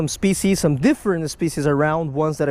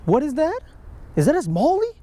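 surprised; 10 semitones higher than usual. Real, not acted. Surprise at an unexpected catch.

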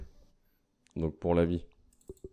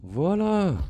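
much higher, sung-out triumphant voilà; triumphant cheer; 9 semitones higher, much slower than usual. Real, not acted. Gamer crowing to his viewers over the kill, high triumphant cheer. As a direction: crow it like a victory song.

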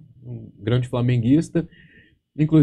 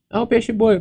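the brat pushing it one more time; kid taunting; 10 semitones higher than usual. Retold or imitated. Beach kid teasing the narrator again, high mocking call.

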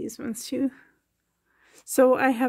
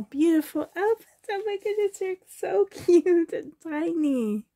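high, gushing squeal of delight; 6 semitones higher, 4 dB louder than usual. Real, not acted. Showing viewers tiny handmade outfits, gushing delight. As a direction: coo excitedly, pitched right up.

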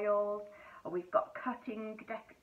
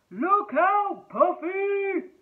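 loud, higher warning shout; 8 semitones higher, 12 dB louder, much slower than usual. Acted. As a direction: yell a warning. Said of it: "he shouted".

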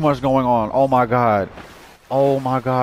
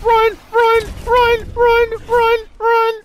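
high-pitched, loud panicked yelps; squealing in a gamer's panic; panicked shriek; 4 dB louder, much slower than usual. Real, not acted. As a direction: shriek each word separately in panic. Fleeing an enemy in the game.

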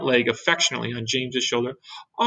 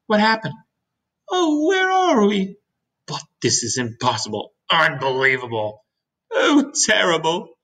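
acted dismay, leaping high on exclamations; alarmed; 6 semitones higher, 3 dB louder than usual. Creatures find themselves adrift at sea.

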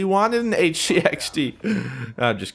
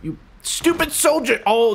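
ordinary talk

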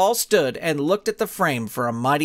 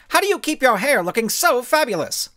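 gushing reporter; 7 semitones higher than usual. A narrator's reading. Reporter asks about her hair.

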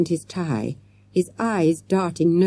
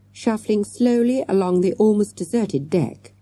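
plain reading voice

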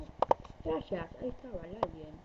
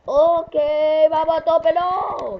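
loud pumped-up yell; excited shout; 8 semitones higher, 17 dB louder, slower than usual. Real, not acted. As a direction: shout it, fired up. Hype burst while playing.

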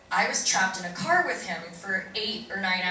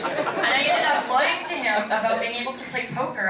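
ordinary delivery

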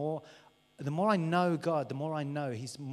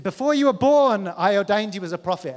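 high, loud divine proclamation; God proclaiming a calling, loud and high; booming declaration; 5 semitones higher, 14 dB louder than usual. Acted. God's voice quoted loudly.

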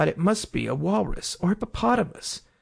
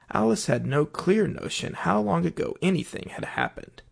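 ordinary delivery

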